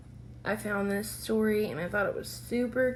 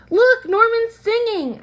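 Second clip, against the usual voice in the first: high, loud delighted cry; excited; 13 semitones higher, 12 dB louder than usual. Acted. Child tells the class the fish is singing, excited and loud. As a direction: blurt it out thrilled.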